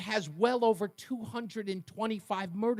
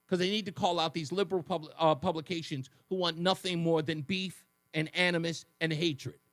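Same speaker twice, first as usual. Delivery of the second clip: ordinary talk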